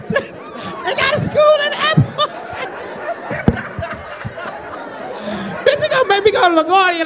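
fast, laughing through the words; cracking up in disbelief. Real, not acted. Laughing disbelief at airport school.

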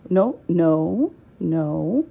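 loud scolding no; firm loud no. Real, not acted. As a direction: call out no sharply. Scolding the cats loudly.

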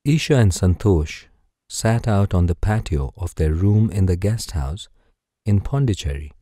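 ordinary delivery

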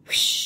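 a bright, loud rocket whoosh; storyteller's playful rocket sound. Pretend. Rocket launch sound effect.

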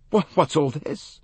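stammered, caught off guard; a husband taken aback; startled Simon. A narrator's reading. Simon startled by the maid's hint.

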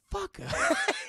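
high breathy laugh; burst of laughter. Real, not acted. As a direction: laugh out loud. Laughs at own joke.

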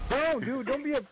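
high, loud yelled protest. Real, not acted. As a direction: yell at him to stop. Friend blowing up his car.

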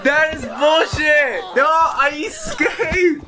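high incredulous yelling; disbelief, voice shooting up; shocked outrage. Real, not acted. Escaped but got caught anyway.

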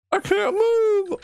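high, slow terrified scream; panicked scream. Real, not acted. Stuck in place, dreading jump scare.

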